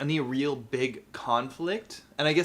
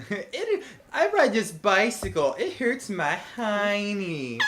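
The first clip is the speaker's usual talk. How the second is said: high, slow cartoon seagull; 7 semitones higher, slower than usual. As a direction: drag it out like a seagull. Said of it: "that's from the Family Guy".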